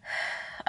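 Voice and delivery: soft re-enacted sigh; soft exhale to settle down. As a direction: let out a quiet calming breath. Calming self in retold moment.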